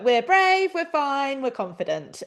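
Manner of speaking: reciting courage words on a hack; recited mantra. Retold or imitated. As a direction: repeat it to yourself to stay brave. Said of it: "kept reciting that to myself".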